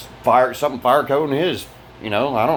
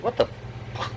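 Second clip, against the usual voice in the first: ordinary talk, nothing marked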